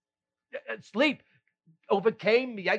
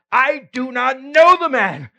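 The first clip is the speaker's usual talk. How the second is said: loud, higher, desperate denial; 8 semitones higher, 11 dB louder than usual. Acted. Peter denying Jesus to accusers, loud higher denial. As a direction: shout the lie to end it.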